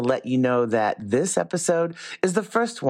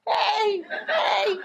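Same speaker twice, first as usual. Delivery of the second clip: mock shout. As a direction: yell it like a driver passing by. Passersby shouting at the couple.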